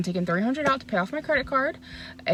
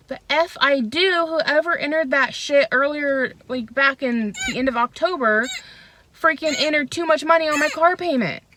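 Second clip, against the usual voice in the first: higher, worked-up retelling of an angry comeback; indignant retort; 6 semitones higher, 4 dB louder than usual. Retold or imitated. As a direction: vent the imagined comeback, pitched up. Retorting angrily to bank in retelling.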